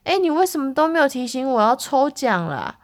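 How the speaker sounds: mock-whiny reproach; retold complaint. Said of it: "所以我就说".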